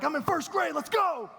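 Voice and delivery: high, loud hyped-up coach yell; intense shout. Retold or imitated. Overexcited teacher to a first grader, intense shout. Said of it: "You're way too intense for me".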